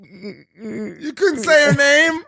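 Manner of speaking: high, slow, laugh-cry disbelief; can barely get it out for laughing; laughing through words. Real, not acted. Host laughing at the story.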